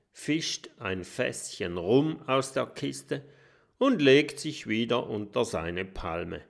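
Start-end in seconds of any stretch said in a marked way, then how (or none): none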